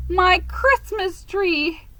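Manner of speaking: high, wailing sobs over each lost thing; grieving each treasure with a broken cry; wailing lament, slow on the last; sobbing. A narrator's reading. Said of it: "sat down on the curb and sobbed".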